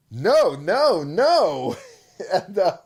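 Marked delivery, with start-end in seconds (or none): 0.1-1.7 higher, louder mock panic; hammed-up gamer dismay. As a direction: cry no in fake horror. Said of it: "And you're like".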